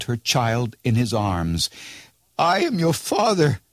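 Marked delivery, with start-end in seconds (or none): 2.4-3.6 put-on much higher, emotional old Rip crying out. A narrator's reading. Old Rip to his daughter, crying out much higher. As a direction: burst out with it, overcome. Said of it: "cried he".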